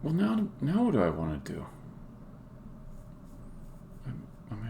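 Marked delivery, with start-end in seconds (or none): none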